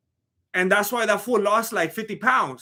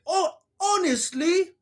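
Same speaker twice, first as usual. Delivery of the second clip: put-on failed British accent; 9 semitones higher than usual. Recalling the Austin Powers line, high failed British accent. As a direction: attempt British indignation, pitched way up. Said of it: "I can't do a British accent".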